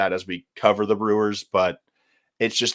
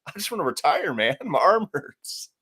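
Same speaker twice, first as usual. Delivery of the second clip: whiny tired pitcher; 8 semitones higher, 3 dB quieter than usual. Retold or imitated. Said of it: "And Adam's like".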